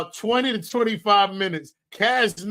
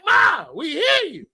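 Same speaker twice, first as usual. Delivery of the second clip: loud, higher call; 6 semitones higher, 3 dB louder than usual. Retold or imitated. Son calling loudly to his mother on the Zoom call. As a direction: shout to warn her the mic is on.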